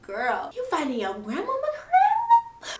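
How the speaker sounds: high, loud, slow, near crying; frantic plea. Retold or imitated. Narrator begging Petunia to quit fighting, high and loud. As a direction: beg her to stop, voice cracking upward.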